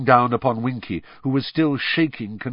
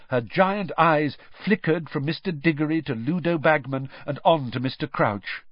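ordinary delivery, nothing marked

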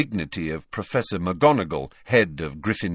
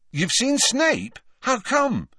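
surprised question; slower than usual. Acted. Ron surprised, mouth full.

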